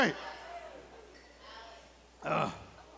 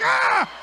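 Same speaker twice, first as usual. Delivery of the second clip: much higher, loud mock shriek; a sudden hammed-up yelp of shock; startled scream; 5 dB louder than usual. Comic startled by the other driver, loud high mock shriek. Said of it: "I was like".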